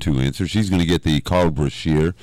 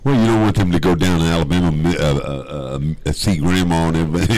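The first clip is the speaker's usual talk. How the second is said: ordinary talk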